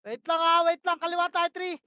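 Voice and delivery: loud, high, urgent calls; yelled commands. Real, not acted. Shotcaller yelling the team to go left. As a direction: call the left turn at full voice.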